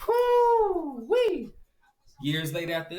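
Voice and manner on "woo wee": high, loud joyful woo-wee; excited whoop. Real, not acted. Shooting into a field, thrilled whoop.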